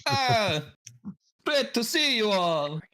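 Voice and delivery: high, hammed-up Jamaican psychic voice; slow, sing-song Jamaican hotline psychic; Jamaican accent. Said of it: "Thank you for that accent".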